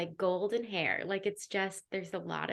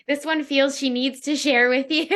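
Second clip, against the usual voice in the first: louder, higher, breaking into laughter; amused, laughing; 5 semitones higher, 9 dB louder than usual. Real, not acted. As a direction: say it through a real laugh. Amused by the eager guide.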